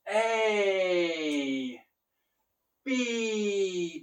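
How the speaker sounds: teacher sounding out letters very slowly; teaching the alphabet. Shown as a demonstration. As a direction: stretch each letter out for beginners. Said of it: "teach them the alph".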